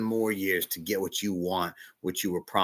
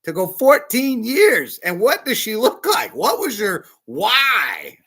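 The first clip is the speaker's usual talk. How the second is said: much higher and louder, slowing on the last question; eager questioning; 9 semitones higher, 7 dB louder than usual. Pretend. Preacher imagining meeting the spirit in heaven, eager wonder. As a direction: burst with wonder at meeting a hero. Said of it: "I can't wait to say".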